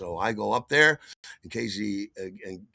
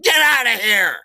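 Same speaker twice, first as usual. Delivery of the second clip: high, full-out angry shout; furious boyfriend's bellowed order, acted; terribly annoyed boyfriend; 11 semitones higher, 13 dB louder than usual. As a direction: yell him out of the room. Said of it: "he is terribly annoyed".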